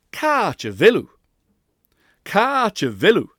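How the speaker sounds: "Ka te vilu" with short unstressed u; loud deliberate drill of "Ka te vilu"; practice drill. Shown as a demonstration. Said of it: "how you must practice it".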